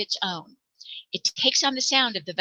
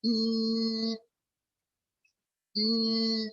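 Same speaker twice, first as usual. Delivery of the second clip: soft hum held through a pinched nose; humming with the nose plugged; 5 dB quieter than usual. Shown as a demonstration. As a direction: hum quietly while holding the nose shut. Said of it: "plug your nose".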